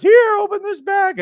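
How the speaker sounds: bright, teasing hand-over from the wife; wife handing over the surprise, eager and loud. Retold or imitated. Wife hands over the test.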